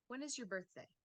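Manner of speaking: falling question ending on BIRTHDAY; WH-question intonation. Shown as a demonstration. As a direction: start "When" high, drop on "birthday". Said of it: "or a W H question".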